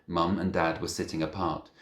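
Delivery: usual speaking voice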